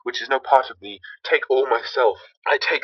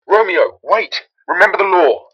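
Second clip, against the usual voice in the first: high, loud shout after him; 5 semitones higher, 5 dB louder than usual. Acted. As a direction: yell to stop him.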